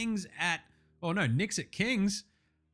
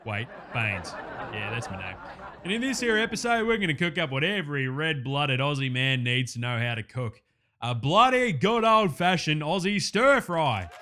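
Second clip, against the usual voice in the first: lower, louder blokey Aussie host; cocky Aussie bloke hosting his own cooking show; ocker cooking host; 3 semitones lower, 3 dB louder than usual. Acted. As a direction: play a larrikin TV chef.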